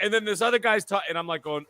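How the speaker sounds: normal talking voice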